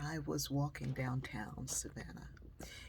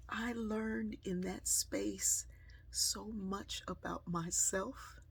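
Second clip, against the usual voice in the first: reflective sermon-like close; 3 semitones higher, 3 dB quieter than usual. Acted. Reflective closing message.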